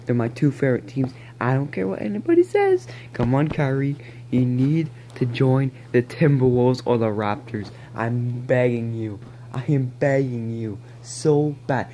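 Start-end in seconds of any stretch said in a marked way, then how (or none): 1.4-2.9 high defiant. Real, not acted. Defiant about favorite teams. 7.9-11.9 slow begging. Real, not acted. Begging Kyrie to join.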